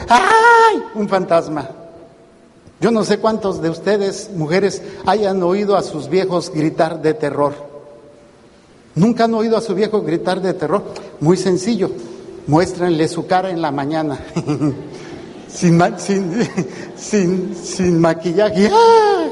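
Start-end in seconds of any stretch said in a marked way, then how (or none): 0.1-0.8 high shrill mock scream of fright; mock terrified scream. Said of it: "gritando como". 14.3-18.7 laughing. Real, not acted. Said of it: "laughing at own joke". 18.7-19.3 high loud mock shriek; mock scream of terror. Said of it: "cualquiera grita".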